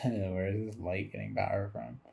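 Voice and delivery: usual speaking voice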